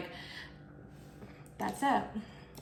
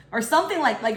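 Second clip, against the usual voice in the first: ordinary talk, nothing marked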